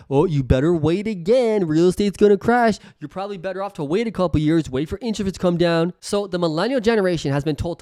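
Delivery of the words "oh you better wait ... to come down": parroting nervous pundits; mocking the talking heads. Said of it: "all the talking heads".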